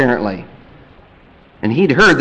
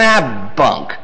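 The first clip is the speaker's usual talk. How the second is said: ordinary delivery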